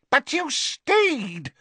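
high, slow accusing taunt; accusing witch. Acted. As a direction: demand it with scorn. Bellatrix accusing.

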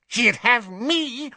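high, slow, fervent witch voice; burn with devotion; passionate. Acted. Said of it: "said Bellatrix passionately".

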